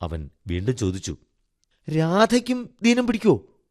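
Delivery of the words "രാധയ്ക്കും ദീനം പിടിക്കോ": put-on very high child's voice; high, childlike wondering; curious little boy. A narrator's reading. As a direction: ask like an anxious small boy. Boy asks Radha about falling sick.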